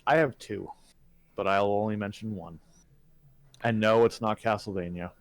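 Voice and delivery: ordinary talk, nothing marked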